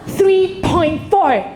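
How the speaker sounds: slow, drawn-out "Three point four"; slow emphatic. Acted. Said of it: "forgive my teacher joke".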